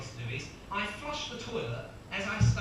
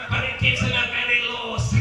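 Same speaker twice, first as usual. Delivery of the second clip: very loud, higher wandering Scottish pundit voice; botched Scottish accent; 4 semitones higher, 13 dB louder than usual. Acted. Co-commentator analysing the racer's build, loud botched Scottish. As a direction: push the failing Scottish accent until it collapses. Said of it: "I don't know what the fuck he's going".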